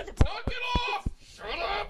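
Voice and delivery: high, loud drawn-out scolding; a boy yelling at his own body; mock yell. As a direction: yell at it to knock it off.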